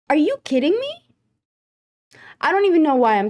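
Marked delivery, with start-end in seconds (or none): 0.1-1.0 high, outraged disbelief; can't believe they'd say this; incredulous. Real, not acted. A fed-up vlogger scoffs at being lied to.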